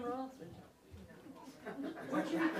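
ordinary delivery

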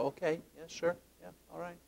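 very soft, noncommittal acknowledgement; forced politeness. Acted. Speaker acting out making himself listen to someone difficult, forced politeness. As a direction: agree politely, keeping it very quiet. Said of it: "make myself be polite".

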